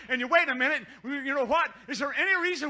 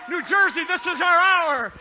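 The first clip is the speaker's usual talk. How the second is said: roaring rallying speech; 5 semitones higher, 10 dB louder than usual. Acted. Repeated rallying cry.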